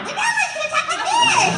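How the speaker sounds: very high, loud character squeal; would-be grandma voice. Shown as a demonstration. Imitating a one-voice hopeful auditioning as grandma, absurdly high. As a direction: badly fake a grandma in a shrieky pitch. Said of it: "What about the grandma?".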